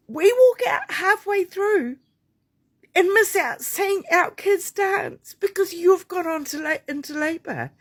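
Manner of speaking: high, tearful voice on the edge of crying; holding back tears. Real, not acted. Midwife recalling leaving her kids' dance recital, choking up. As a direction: voice breaking as she fights real tears. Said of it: "Sorry, I don't mean to cry".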